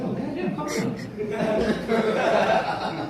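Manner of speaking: very high, soft protest; mock protest. Husband answering his scolding wife, high mock protest. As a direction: squeak back defensively, keeping it down.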